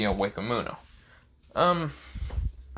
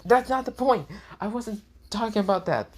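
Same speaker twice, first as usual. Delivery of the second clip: much higher, louder indignant denial; flustered high denial; high-pitched protest; 11 semitones higher, 3 dB louder than usual. Acted. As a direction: squeal it defensively. Iza snapping at her captain.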